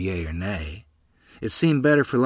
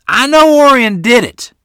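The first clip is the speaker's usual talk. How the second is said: much higher, louder, accusing shout; adamant accusation; 13 semitones higher, 13 dB louder than usual. Acted. Neighbor mother accusing the boy across the road, furious shout. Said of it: "stated adamantly".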